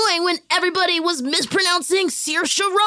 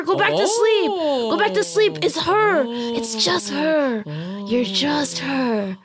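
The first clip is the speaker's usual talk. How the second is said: soothing; 9 semitones lower than usual. Acted. Soothing the startled woman back to sleep, gently. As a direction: lull her back to sleep.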